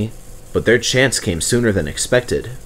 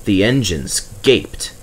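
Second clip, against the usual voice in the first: usual speaking voice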